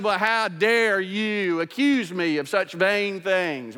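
slow, drawn-out mock indignation; righteously indignant. Abner answering Ishbosheth's accusation, righteously indignant. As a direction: play the wronged man, stretching out each word. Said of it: "plays the, the card of being righteously indignant".

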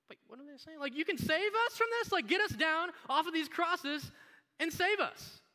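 high, loud incredulous plea; demanding criminal. Acted. Crucified criminal calling on Jesus, high urgent demand. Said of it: "we got a criminal over here".

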